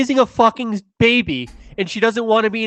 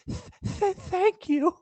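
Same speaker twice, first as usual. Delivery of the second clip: meek stammering victim; 13 semitones higher, 8 dB quieter, slower than usual. Acted. Tom's meek last words.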